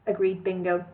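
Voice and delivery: plain reading voice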